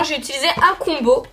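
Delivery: ordinary talk, nothing marked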